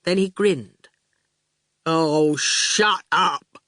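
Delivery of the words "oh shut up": slow, placid goon voice; slow daft goon. A narrator's reading. Goon answers Howard, slow and placid. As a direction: drawl it calmly.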